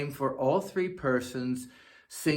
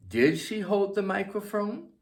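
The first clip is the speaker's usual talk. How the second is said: higher question, rising to the end; 7 semitones higher than usual. Shown as a demonstration. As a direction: lift the voice into a yes-no question.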